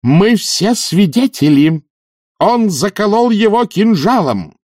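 high, slow accusing cry; triumphant yell. A narrator's reading. Witch denounces the killer before the audience, yelling triumphantly. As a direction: proclaim it high, stretching the words in triumph. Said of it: "завопила она с торжеством в голосе".

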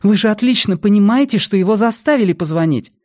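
high, loud indignant protest; angry accusation. A narrator's reading. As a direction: throw it at them, pitched right up. Saga, furious, kicks bin, accuses.

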